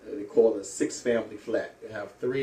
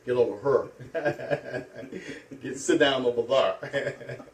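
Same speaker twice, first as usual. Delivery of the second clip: slow, laughing Saint Louis accent; 3 semitones higher, slower than usual. Shown as a demonstration. Man shows listeners his old Saint Louis accent, laughing. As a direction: drawl "over her" the Saint Louis way, chuckling. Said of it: "In Saint Louis, we don't pronounce those words like that".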